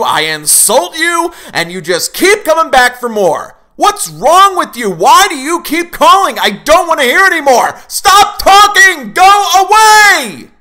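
a cornered man losing control and yelling; furious outburst. Acted. Host melts down at his callers.

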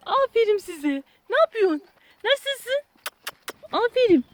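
high sing-song praise. Real, not acted. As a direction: chirp praise at the dogs. Baby talk to dogs. (köpeklere yüksek, tiz bebek konuşması)

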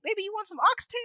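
high, coaxing offer; doting grandma. Retold or imitated. Grandma offering the boyfriend oxtail, high and coaxing. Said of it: "grandma offering boyfriend food".

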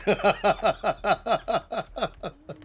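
long rattling run of laughs; drawn-out staccato giggle; real laughter. Real, not acted. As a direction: keep laughing in a steady staccato. Laughing at own remark.

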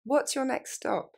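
quick, natural what's your next stop. Shown as a demonstration. Teacher modelling for students, quick natural. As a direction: run it together like a native. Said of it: "Plus rapidement, plus naturellement".

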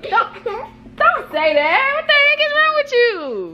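high indignant; high mock scold. Real, not acted. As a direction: protest in disbelief. Scolding daughter's remark.

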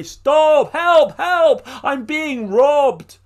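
high, loud desperate shouts; cry for help. Acted. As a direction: shout for help while being robbed. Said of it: "Ivan heard a cry".

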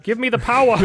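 put-on high, loud botched British accent; failed English accent. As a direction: try for an English accent and miss. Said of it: "I forgot how to do an English accent".

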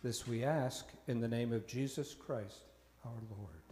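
usual speaking voice

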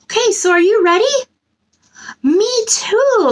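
ordinary delivery

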